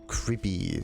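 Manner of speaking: ordinary delivery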